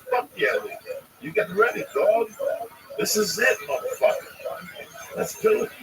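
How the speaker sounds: loud hype-man pep talk; pumped up. Acted. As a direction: fire him up before the match. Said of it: "He sounds enthused".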